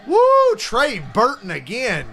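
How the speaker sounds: high, loud whoop into a drawn-out name; thrilled fan hollering at the game; excited cheer. Real, not acted. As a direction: cheer the play at full voice. Cheering a live catch.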